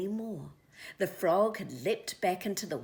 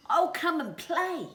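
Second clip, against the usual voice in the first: put-on high, loud baby owl voice; little morepork calling; 12 semitones higher, 9 dB louder than usual. A narrator's reading. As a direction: call down from the tree. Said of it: "the little morepork called out".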